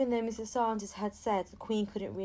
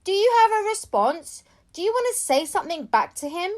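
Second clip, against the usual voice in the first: loud, high shout; reporter shouting; 9 semitones higher, 11 dB louder than usual. A narrator's reading. Second reporter shouting at the children, loud and pushy. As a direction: yell it out to get their attention. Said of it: "And another one shouted".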